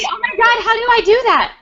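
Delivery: high-pitched playful outburst; mock desperate excitement. Host cutting in on guest's boyfriend-attention sketch, mock desperate.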